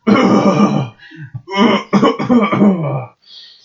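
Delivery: high, loud hacking cough; old man cough. Retold or imitated. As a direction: hack like a frail old mobster. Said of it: "as close to Junior as I can get".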